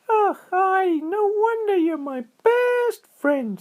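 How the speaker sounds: very high, slow, grateful squirrel voice; high critter voice, moved; touched squirrel. A narrator's reading. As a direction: squeak it with delight. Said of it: "said Squirrel".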